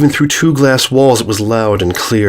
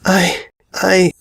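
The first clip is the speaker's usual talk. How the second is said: higher, halting stammer of shock; can't get the words out; shocked filly stammers in front of Celestia; 9 semitones higher than usual. A narrator's reading. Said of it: "Sweetie stammered".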